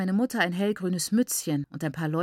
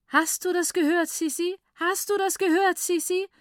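melodic, stretched calling; 10 semitones higher than usual. A narrator's reading. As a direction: call out joyfully, stretching each word melodically. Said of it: "jedes Wort melodisch dehnend".

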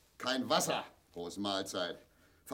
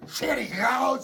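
furious shout; 11 semitones higher, 7 dB louder, slower than usual. Acted. Father in pain roaring at his family to get out, furious shout. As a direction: bellow it high and loud, throwing them out.

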